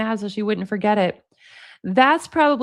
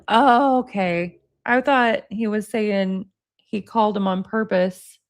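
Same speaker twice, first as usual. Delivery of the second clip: normal talking voice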